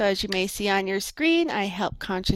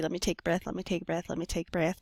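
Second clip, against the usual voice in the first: ordinary talk, nothing marked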